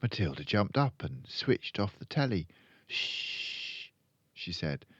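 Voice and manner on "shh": soft, long shushing; drawn-out shh; hushing. A narrator's reading. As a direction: hush the family urgently. Matilda hushes the family.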